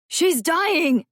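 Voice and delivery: panicked high scream. A narrator's reading. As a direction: scream it out in terror. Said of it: "Gabriel screamed".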